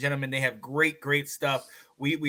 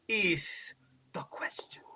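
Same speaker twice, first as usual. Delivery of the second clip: slow stage whisper; whispered Shakespearean finish; dramatic whisper; 10 dB quieter, slower than usual. Acted. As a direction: hush it out like a soliloquy ending. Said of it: "not to go too Shakespearean".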